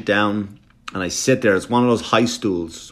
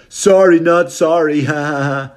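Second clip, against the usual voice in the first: higher, louder mocking kid with laugh; bratty kid; 5 semitones higher, 4 dB louder than usual. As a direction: imitate a cheeky twelve-year-old, laughing. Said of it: "This is kid, maybe twelve".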